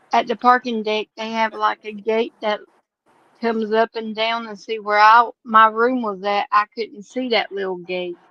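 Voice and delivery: ordinary talk, nothing marked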